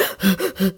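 quick, audible gasping breaths; hard breathing. Shown as a demonstration. Swimmer floating but still anxious, imitated hard gasps. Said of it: "just breathing pretty hard".

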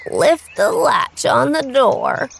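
slow, frail grandmother call; lightly voiced old granny. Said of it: "called out the grandmother".

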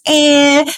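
high, drawn-out nasal wail on "Eh"; crying vowel. Shown as a demonstration. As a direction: make "Eh" cry before a nasal. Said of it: "It goes".

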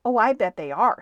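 loud, punchy comeback; insistent retort. Acted. Stuart insisting to the cat on his muscles, loud retort. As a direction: throw it back loudly.

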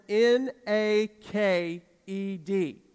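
slow, loud letter-by-letter spelling; drawn-out, emphatic spelling; spelled out. Retold or imitated. Spelling out a word on request.

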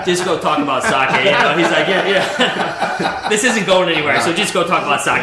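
laughing retold quote. Sushi chef retelling boss's dismissal, laughing loudly. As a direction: crack up while quoting the chef.

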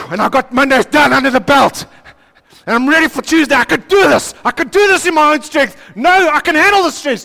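high, loud, straining self-assurance; forced confidence. Acted. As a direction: shout that you can handle it. Acted week, strength building up.

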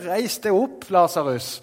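ringing summons to the dead man; calling out. Acted. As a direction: raise him with your voice. Preacher acts Jesus calling out.

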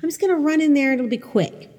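high, airy mock confidence; a lightly voiced overconfident new mom; naive breezy self. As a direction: play your clueless past self, breezy and sure. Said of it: "There are those air quotes again".

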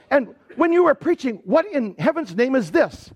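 imitating a baffled critic; retold bafflement. Professor's baffled criticism, acted out.

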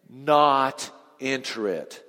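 Not enter it, each word slow and heavy; a solemn slow warning; slow grave verdict. Real, not acted. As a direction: spell out the verdict slowly. Preacher stresses stern verdict.

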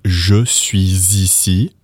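slow "je suis ici" with a z linking "suis" to "ici"; drawn-out textbook "je suis ici", z sound before "ici"; liaison. Shown as a demonstration. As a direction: pronounce "je suis ici" as "zhuh swee-zee-see". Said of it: "to do the liaison".

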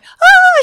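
high, loud, long wail; mock shaken scream. Colleague still shaking after elevator scare, mock high wail. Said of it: "She's still shaking".

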